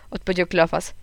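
usual speaking voice

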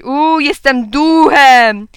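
loud, drawn-out, high ghost hoot; pretend ghost. A narrator's reading. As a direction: stretch it slowly like a child playing ghost. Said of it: "zaczął wołać". (przeciągłe, głośne udawanie ducha)

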